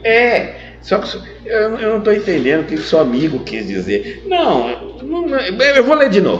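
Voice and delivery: put-on a boy who won't give up rereading; lightly voiced child pleading; insistent son.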